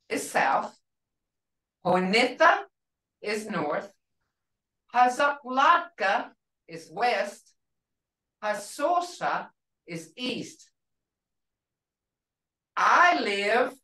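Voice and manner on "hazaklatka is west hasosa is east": each name said slowly and clearly for a learner; Hazaklatka, Hasosa spelled out slowly syllable by syllable; slow teaching words. Shown as a demonstration. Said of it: "So you're learning directions here now".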